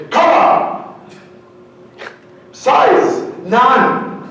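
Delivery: high, loud mock lawyer scoff; hammed-up lawyer brushing it off. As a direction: scoff like a lawyer cutting you short. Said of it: "they say".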